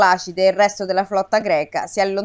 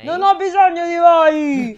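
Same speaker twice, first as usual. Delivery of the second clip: higher, louder shout at the heavens; shouted defiance; 7 semitones higher, 5 dB louder than usual. A narrator's reading. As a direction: hurl it at the gods. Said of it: "urlò ancora". (Aiace urla di nuovo contro gli dei)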